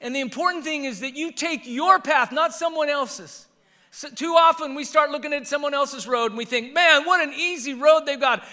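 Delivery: high, loud preaching at full force; forceful preaching. Real, not acted. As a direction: drive it home to the congregation.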